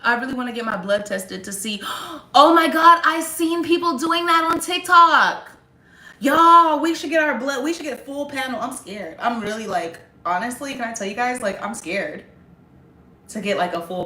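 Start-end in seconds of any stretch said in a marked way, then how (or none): 1.9-7.8 gasp, then a high, loud, excited outburst; suddenly thrilled by the idea; high and loud, bursting with excitement. Real, not acted.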